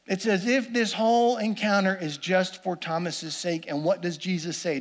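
ordinary delivery

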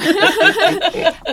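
high laughing burst; burst of laughter. Real, not acted. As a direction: laugh out loud. Laughs at skinny dip.

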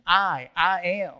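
"I, I am" said slow and loud, in bold letters; emphatic I am. Shown as a demonstration. Preacher to congregation, bold emphatic "I, I am". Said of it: "like throwing it out there almost in bold letters".

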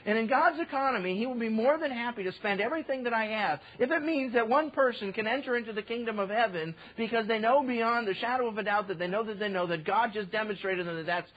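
normal talking voice

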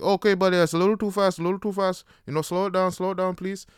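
loud, high-pitched pleading to ease off; alarmed instructor. Retold or imitated. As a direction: imitate an instructor trying to rein in a speeder. Instructor alarmed at his speed.

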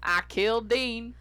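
slow, imitated Texas drawl; Texas accent. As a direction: mimic his Texas accent, stretching the words. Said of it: "You can hear the Texas in his voice".

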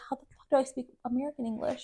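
quick mock British accent, half laughing; puzzled, put-on British accent. Host retelling getting stuck while drunk, British accent. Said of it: "like, a British accent".

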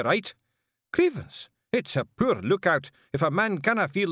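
brawling wee Scottish men bickering; Feegle voices. Acted.

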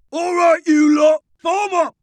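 high parade-ground scream; screamed orders. A narrator's reading. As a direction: yell the platoon into line. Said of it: "Bert screamed his platoon into battle array".

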